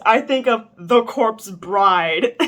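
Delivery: loud, incredulous outburst; drawn-out, amused incredulity at the end; amused disbelief. Real, not acted. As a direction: say it loudly, on the edge of laughter. Incredulous reaction to the story's line.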